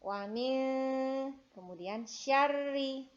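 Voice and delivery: syarri slow with short sya and doubled rr; over enunciating. Shown as a demonstration. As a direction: spell out syarri slowly. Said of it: "tetap pendek".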